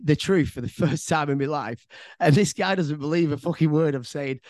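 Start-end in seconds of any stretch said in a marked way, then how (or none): none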